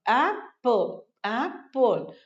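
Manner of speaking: "एप्पल" broken into ap, pause, pol; split syllables. Shown as a demonstration. Teacher drilling two-syllable words, "एप्पल" split as ap - pol. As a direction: say each "एप्पल" in two separate beats. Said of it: "दो syllable से बने हैं".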